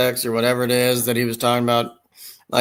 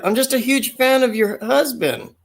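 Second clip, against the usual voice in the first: mock eager fan; 12 semitones higher than usual. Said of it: "I was like".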